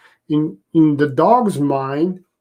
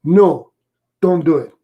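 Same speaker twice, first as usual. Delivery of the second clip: firm no; 4 dB louder than usual. Shown as a demonstration.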